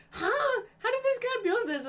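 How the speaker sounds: baffled high "Huh?"; high, loud amazement; surprised. Retold or imitated. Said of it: "And I'm like".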